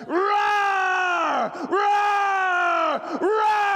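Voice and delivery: high drawn-out roaring yell; lion roar shout. Acted. Preacher voicing the beast's roar at the sheep lion, long shout. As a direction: roar it like a lion across the river.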